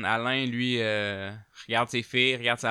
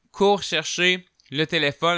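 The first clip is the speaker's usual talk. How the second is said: usual speaking voice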